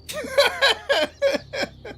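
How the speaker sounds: long, high-pitched laughing fit; amused laugh. Real, not acted. Storyteller amused by the Boston coincidence, amused laugh. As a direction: crack up at your own story.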